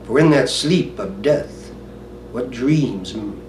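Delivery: low, soft Hamlet soliloquy with long pauses; brooding soliloquy. Acted. Hamlet pondering whether to be or not, hushed soliloquy.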